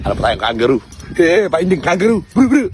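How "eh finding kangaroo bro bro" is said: excited high call. Real, not acted. Excited calling kangaroo.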